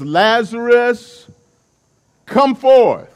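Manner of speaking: loud, high, slow summons; calling out. Retold or imitated. Jesus calling dead Lazarus from the tomb, slow loud summons. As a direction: call him out of the grave, loud and drawn out.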